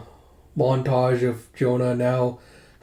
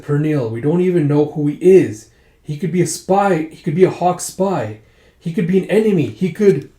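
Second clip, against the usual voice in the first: higher, louder insistent warning; alarmed arguing; 5 semitones higher, 7 dB louder than usual. Acted. Relative warns Pernille about a possible spy, alarmed and insistent. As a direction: argue it urgently, pitch climbing with each point. Acted family argument, warning her.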